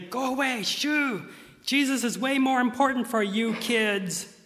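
high, scolding shoo; shooing disciples. Retold or imitated. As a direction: shoo the kids away sharply. Disciples shooing children away.

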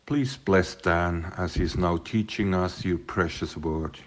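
ordinary delivery

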